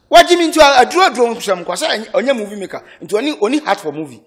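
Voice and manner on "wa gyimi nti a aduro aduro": high, loud burst of scorn; heated outburst. Real, not acted. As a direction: erupt with indignation. Heated rant about movie makers.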